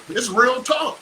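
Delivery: ordinary talk, nothing marked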